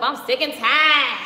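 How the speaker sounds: loud, slow, drawn-out mock groan; mock complaint. Mocking viewers who wake up complaining, loud and slow. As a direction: ham up the tired whine. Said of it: "Some of y'all woke up".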